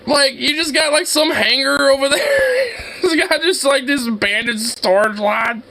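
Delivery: high voice breaking with laughter; helpless laughing disbelief. Real, not acted. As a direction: squeeze the words out while cracking up. Cracking up at abandoned lots.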